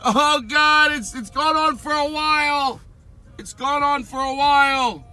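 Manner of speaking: very high, frantic falsetto yell; mock yelling down. Woman yelling down from the house, high mock shriek. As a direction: yell down in hammed-up excitement. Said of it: "She's yelling down".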